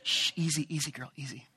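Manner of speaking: soft shushing horse-calming voice. Shown as a demonstration. Speaker calming a horse, soft soothing shush. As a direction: ease a skittish horse, softly. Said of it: "I used to do that to my wife".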